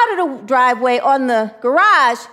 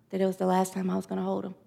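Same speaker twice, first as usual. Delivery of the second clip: hushed dread; 4 semitones lower, 10 dB quieter, faster than usual. Retold or imitated. Premonition of losing baby.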